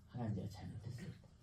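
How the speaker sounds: a very soft, broken confession to God; hushed repentance. Retold or imitated. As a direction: say it almost under your breath, contrite. 회개하는 기도 재연. (아주 작게, 뉘우치며 고백하듯)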